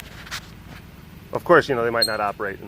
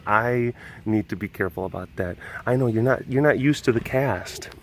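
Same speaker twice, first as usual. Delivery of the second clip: ordinary talk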